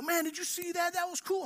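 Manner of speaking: high, hushed excitement; pumped. Retold or imitated. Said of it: "and you're just pumped".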